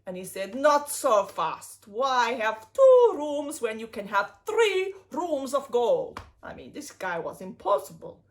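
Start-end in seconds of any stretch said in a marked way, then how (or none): 0.5-6.2 a hint of a slow, louder greedy king. Greedy king demands more gold, slow and louder. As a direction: draw it out, slow and pushy. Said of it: "the king was greedy".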